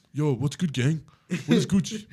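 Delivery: hammed-up slang from a newcomer; mock Russian learner. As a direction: play a foreigner trying out slang. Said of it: "be like".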